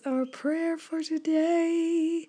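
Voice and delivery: voice cracking high. Real, not acted. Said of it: "oh my goodness".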